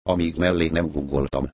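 ordinary delivery, nothing marked

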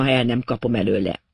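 ordinary delivery

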